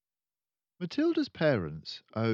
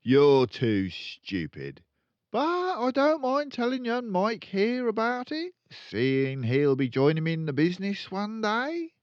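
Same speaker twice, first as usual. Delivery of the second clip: boastful father; 12 semitones higher, 7 dB louder than usual. Acted. Father sneering at Matilda, boasting to his son. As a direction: sneer then swell with pride. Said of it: "the father said".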